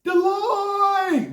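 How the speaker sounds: high, far-off panicked cry; panicked roach. Retold or imitated. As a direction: cry out like a scared bug. Roaches fleeing the light.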